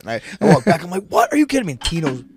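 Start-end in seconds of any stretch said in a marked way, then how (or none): 1.1-1.7 high, loud disbelief; dad screaming at the referee, high and loud; outraged yell. Retold or imitated. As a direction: shout it at the ref. Yelling at ref.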